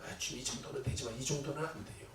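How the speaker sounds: low, soft mock bargaining with God; bargaining prayer. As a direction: haggle with the Lord under your breath. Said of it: "주님을 컨트롤하고".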